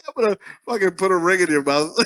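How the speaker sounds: playful threat broken by laughing; laughing mock threat. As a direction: tease through laughter, rushing it out.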